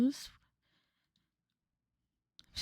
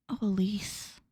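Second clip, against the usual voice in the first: whisper; 5 dB quieter, slower than usual. Acted. Tender aside to Elise.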